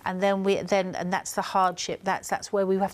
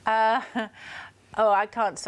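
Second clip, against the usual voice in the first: normal talking voice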